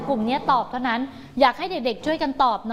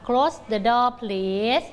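slow model reading; much slower than usual. Shown as a demonstration. Teacher repeating the answer sentence. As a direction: Close the door please said slowly. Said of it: "โยงไปเลยค่ะ".

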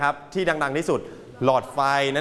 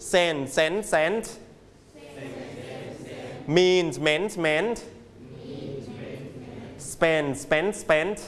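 very slow, each verb form spaced out; teacher drills the class slowly; slow model for repeating; 3 dB quieter, much slower than usual. Shown as a demonstration. Teacher drills students at a slow repeat-after-me pace. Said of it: "Everybody repeat after me".

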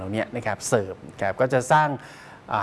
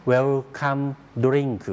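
"Welcome Drink" in Japanese loanword sounds; Japanese pronunciation; 2 semitones higher, much slower than usual. Shown as a demonstration. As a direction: demonstrate "Welcome Drink" as a Japanese speaker says it. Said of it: "ภาษาญี่ปุ่นก็จะออกเสียงเป็น". (สำเนียงญี่ปุ่น เวรุคามุ โดริงกุ)

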